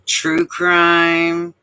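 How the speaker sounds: ordinary delivery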